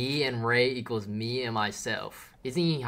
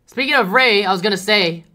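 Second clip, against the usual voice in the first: loud; 9 semitones higher, 16 dB louder than usual. Real, not acted. Loud start of topic.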